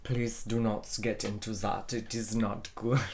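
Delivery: put-on careful, separated words in a Latin accent. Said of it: "I'm not doing a proper a-, um, Latin accent".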